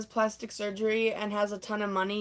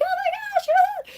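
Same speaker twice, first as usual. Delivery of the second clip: very high, loud reunion squeal; mock excited squeal; 8 dB louder than usual. Best friends meet up after so long, mock squealing.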